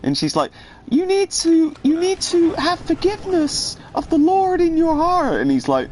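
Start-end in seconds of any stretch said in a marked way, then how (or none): 0.9-5.4 much higher, manic voice of a zealot; a high, frantic impression of the woman; high mock preacher woman. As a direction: squeak it like an unhinged street evangelist.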